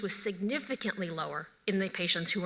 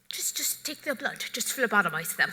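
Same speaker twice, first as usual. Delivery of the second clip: put-on terrible French accent; 3 semitones higher than usual. Quoting French colleagues at a meeting, in a hammed-up French accent. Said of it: "Sorry, terrible accent".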